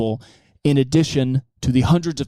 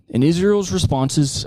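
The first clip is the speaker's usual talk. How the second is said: normal talking voice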